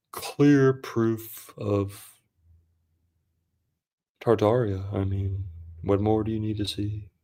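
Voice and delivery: very slow, drawn-out mock narrator; hypnotic, unhurried conspiracy narrator; [name]. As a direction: stretch the words with long pauses, then go soft. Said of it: "That was [name]".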